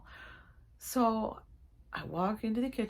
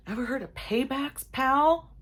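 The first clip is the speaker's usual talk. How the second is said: high, loud teasing menace; playful tough-guy warning; mock threat; 8 semitones higher, 6 dB louder, slower than usual. Warning husband about payback, mock threat.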